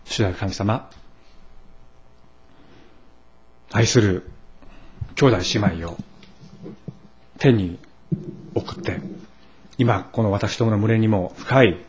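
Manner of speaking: hushed, reverent praying voice; prayer. Acted. Pastor leading the congregation in prayer, hushed and reverent.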